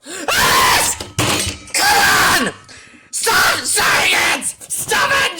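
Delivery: high, loud raging shouts. Real, not acted. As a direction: yell it out in a full rage. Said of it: "I've never ever raged so hard".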